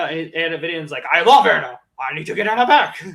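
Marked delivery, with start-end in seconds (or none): 1.1-3.0 a devoted monster pleading; hammed-up Venom voice; Venom impression. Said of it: "That's a good Venom".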